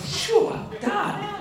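laughing dismissal. Retold or imitated. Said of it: "I'm like".

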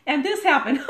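high, loud, breaking into laughter; acted laughing. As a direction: imitate a friend telling it and laughing. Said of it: "You wanna hear their laugh".

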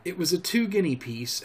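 ordinary delivery, nothing marked

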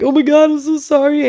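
retold crying apology. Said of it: "I just start crying".

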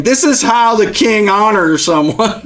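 high, slow, laughing through a grudging announcement; laughing mock proclamation. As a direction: proclaim it while chuckling at him. Haman forced to proclaim.

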